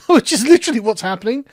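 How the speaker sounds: usual speaking voice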